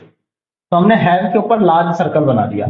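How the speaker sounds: normal talking voice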